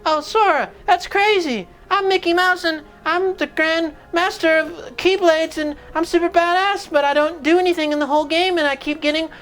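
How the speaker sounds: high, squeaky mock of a bad Mickey; mocking Mickey Mouse. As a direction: sneer at the voice with a high cartoon squeak. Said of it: "He goes".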